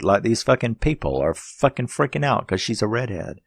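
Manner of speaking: ordinary delivery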